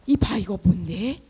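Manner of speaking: ordinary talk, nothing marked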